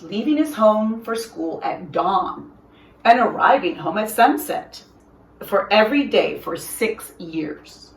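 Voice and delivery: usual speaking voice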